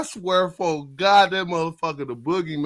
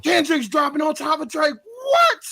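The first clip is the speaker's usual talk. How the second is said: mock shock; 12 semitones higher than usual. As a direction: gasp at the news. Retold shock at news.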